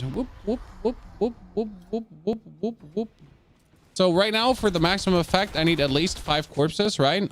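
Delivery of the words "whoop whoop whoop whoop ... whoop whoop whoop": short, high, soft whoops in a quick string; playful whooping. Pretend. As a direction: toss out little high-pitched whoops. Imitated whooping during gameplay.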